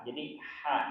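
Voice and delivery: normal talking voice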